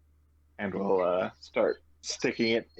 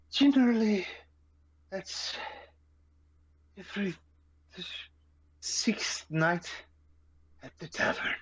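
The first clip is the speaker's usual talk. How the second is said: sighing, higher voice in broken phrases; worn out, long gaps between a few words; exhausted character; 10 semitones higher than usual. Acted. Traveler answering about the tavern storytelling night, exhausted. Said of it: "exhausted, rather".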